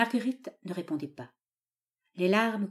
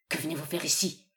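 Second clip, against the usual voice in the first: plain reading voice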